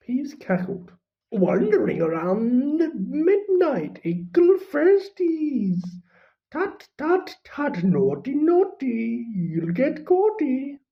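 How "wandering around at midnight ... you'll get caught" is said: high, sing-song teasing voice; mischievous poltergeist, much higher and tauntingly slow; gleeful poltergeist taunt. Acted. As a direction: gloat over the caught children. Peeves taunting the students.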